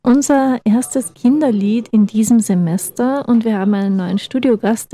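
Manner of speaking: ordinary talk, nothing marked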